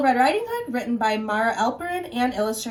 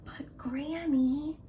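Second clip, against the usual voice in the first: slow hushed whisper; frightened girl whispering; 5 semitones higher, 5 dB quieter, slower than usual. Acted. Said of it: "Little Red whispered".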